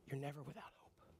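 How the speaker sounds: very soft, tender promise; quiet, consoling near-murmur; gentle reassurance. Acted. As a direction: hush down to comfort the discouraged. God's answer to the discouraged.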